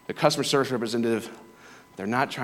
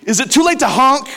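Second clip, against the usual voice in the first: high, loud mock-indignant wife; Roberta's comic high-pitched outburst about honking; imitating Roberta; 13 semitones higher, 8 dB louder than usual. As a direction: squeak it out high and loud like a fed-up passenger. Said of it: "She's like".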